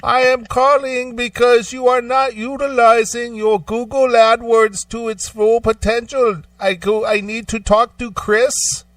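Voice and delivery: put-on high Indian accent telemarketer; Indian accent impression. Caller mimicked to friend in high Indian accent. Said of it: "thick Indian accent".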